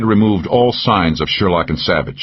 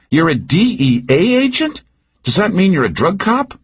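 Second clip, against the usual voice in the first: higher, teasing woman's voice; Cal's voice; 13 semitones higher than usual. A narrator's reading. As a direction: needle him with a pitched-up lilt. Narrator voices Cal.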